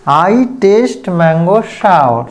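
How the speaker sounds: very slow, careful model sentence; slow correct example. Shown as a demonstration. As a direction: spell it out slowly for learners. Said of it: "तो सही है". (धीरे-धीरे सही वाक्य)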